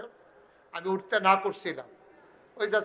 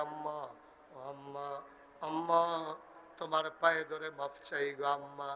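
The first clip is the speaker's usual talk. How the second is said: soft pleading; 2 semitones lower, 8 dB quieter than usual. Acted. Son asking his mother's forgiveness, hushed pleading. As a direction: beg very softly, as if at her feet.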